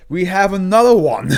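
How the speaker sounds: high, loud disbelieving cry; finding yet another beheading entry, an excited loud cry; incredulous exclamation. Real, not acted. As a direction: shout it in amused disbelief. Another decapitation SCP found.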